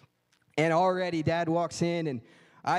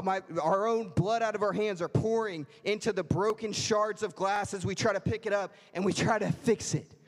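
ordinary delivery

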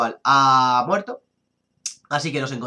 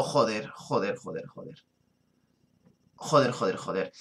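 cursing under breath; 6 semitones lower, 7 dB quieter than usual. Real, not acted. Swearing after losing a Pokémon.

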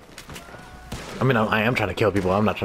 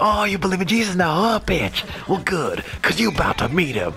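gloating movie line; 10 semitones higher than usual. Retold or imitated. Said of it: "And he's like".

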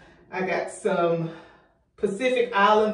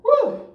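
1 high woo; 4 dB louder than usual. Real, not acted. Exhausted, catching her breath.

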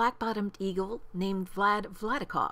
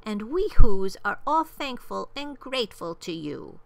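high, proud little mayor; piping, thankful small-town official; tiny mayor calling; 7 semitones higher, 6 dB louder than usual. A narrator's reading. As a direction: call up gratefully in a high voice, building louder to the end. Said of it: "called the voice".

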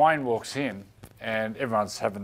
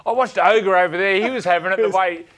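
higher, louder hurried excuse voice; mock excuse; 7 semitones higher, 8 dB louder than usual. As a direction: bluff your way out excitedly. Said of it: "He said".

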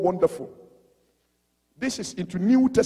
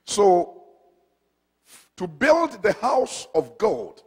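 low, measured preaching; calm sermon opening; 9 semitones lower, 5 dB quieter, slower than usual. Acted. Preacher introducing the sermon title to the congregation, measured. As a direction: lay out the sermon points slowly and steadily.

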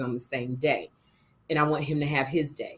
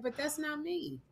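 retold quiet denial; 9 semitones higher, 15 dB quieter than usual. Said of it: "I was like".

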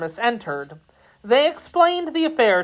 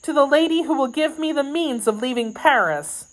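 higher, earnest maid's voice; grateful maid; 7 semitones higher than usual. Acted. Kitty promising devotion to her new lady, grateful. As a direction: vow devotion in a raised, eager pitch.

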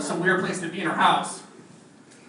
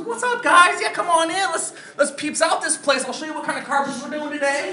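much higher, girlish mock voice of the fifty-year-old gal; 7 semitones higher than usual. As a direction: play her as a woman acting twelve, pitched way up. Gal's voice acted in story.

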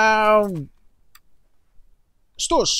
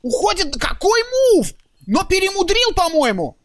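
excited; 13 semitones higher, 4 dB louder than usual. Real, not acted. Caster yells at clutch play.

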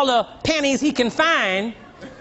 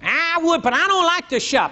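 higher, lightly whiny excuse; husband's excuse; 7 semitones higher than usual. Retold or imitated. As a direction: shrug it off lazily.

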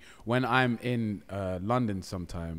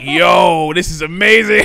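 high, loud burst of delight; excited amazement; 7 semitones higher, 17 dB louder, slower than usual. Retold or imitated. Speeder retelling his lucky escape, excited amazement. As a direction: shout it out, thrilled, pitch soaring.

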